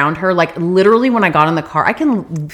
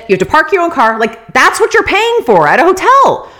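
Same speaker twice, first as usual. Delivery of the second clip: angry rant; 9 semitones higher than usual. Real, not acted. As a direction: vent about the useless hotel. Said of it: "I was just fucking angry".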